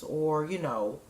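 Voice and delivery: ordinary talk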